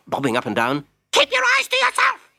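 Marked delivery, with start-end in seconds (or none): 1.1-2.3 very high squeaky jeering voice; mock him like a nasty spring toy; jack-in-the-box voice. Jack-in-the-box taunting soldier.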